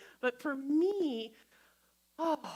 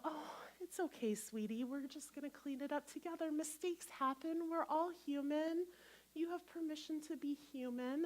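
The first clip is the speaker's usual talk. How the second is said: hushed, tender reassurance; soft, gentle comforting; 3 semitones higher, 6 dB quieter than usual. Shown as a demonstration. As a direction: speak softly, as to an upset toddler. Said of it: "speak softly to yourself".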